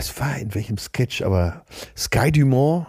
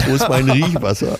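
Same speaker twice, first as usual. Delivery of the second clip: ordinary talk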